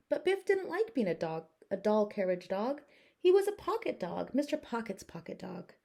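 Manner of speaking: plain reading voice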